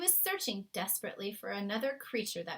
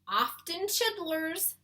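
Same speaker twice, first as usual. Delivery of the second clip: slow, high, drawn-out giant's voice; the giant lingering on his made-up word; BFG voice; 9 semitones higher, 5 dB louder, much slower than usual. A narrator's reading. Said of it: "the B F G said".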